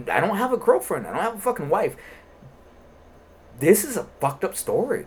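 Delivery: worked up. Real, not acted. Telling his co-host how messed up the story is, worked up and high. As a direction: get fired up about it.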